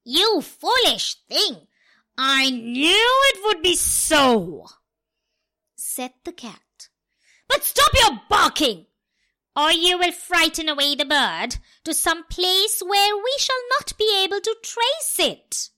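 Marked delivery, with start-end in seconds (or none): 0.0-4.7 loud scolding as the cat. Acted. Cat scolding the dog, loud. 7.5-15.7 loud, higher scolding; scolding cat. Acted. Cat telling the dog to stop barking, loud.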